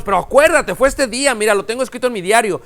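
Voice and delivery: imita un reclamo agitado, agudo y fuerte; reclamo insistente. Retold or imitated. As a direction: insiste dato tras dato para que recuerde. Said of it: "una persona trata de enfrentarlos". (reclamo insistente, imitando al ofendido)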